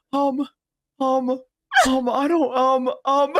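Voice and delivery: put-on high, halting little-kid dithering; hesitant child. Kid trying to grab a frog, imitated nervous hesitation.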